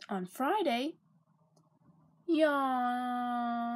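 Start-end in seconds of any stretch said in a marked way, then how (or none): 2.3-3.7 "yawn" said through a real yawn; bored yawn. A narrator's reading. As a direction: let the word melt into a yawn. Friday boredom.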